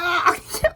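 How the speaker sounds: high burst of laughter. Real, not acted. Laughing at her own story of refusing him, high laugh.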